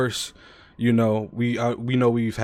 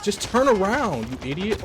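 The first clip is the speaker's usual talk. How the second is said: frustrated yell; 11 semitones higher than usual. Real, not acted. Yelling at enemy in game.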